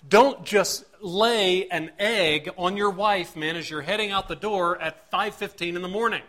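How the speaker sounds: ordinary talk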